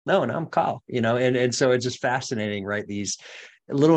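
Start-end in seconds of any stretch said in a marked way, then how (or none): 0.0-0.8 retold surprised objection, pitched up; mock protest. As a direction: jump in to object, acting it out. Acted protest at name mispronunciation.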